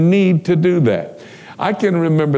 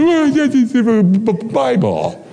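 nervous stammer; 9 semitones higher than usual. Retold or imitated. Hitchhiker startled by Bible.

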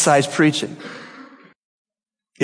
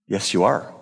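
quiet answer; 8 semitones lower, 6 dB quieter than usual. Real, not acted. Answers own question to congregation.

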